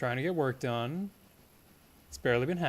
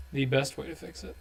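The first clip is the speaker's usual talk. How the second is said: normal talking voice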